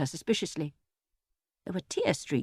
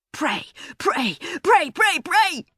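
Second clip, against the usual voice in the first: frenzied shouting; 10 semitones higher, slower than usual. A narrator's reading. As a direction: chant it in a frenzy. Erg winding frenzied.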